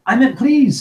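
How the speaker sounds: high, loud hasty protest; alarmed backpedalling; hurried protest. A narrator's reading. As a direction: blurt it out to take it back. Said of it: "said Harry quickly".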